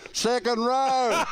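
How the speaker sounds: high, slow, loud call of "Second row!"; yelled out. Retold or imitated. Player yelling to the coach from the field, loud. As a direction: yell it across the field. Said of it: "he yells out".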